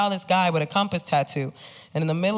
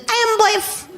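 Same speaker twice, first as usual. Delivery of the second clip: very high toddler imitation; cute toddler voice; 6 dB louder than usual. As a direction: lisp it like a tiny child. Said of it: "that cute little toddler voice".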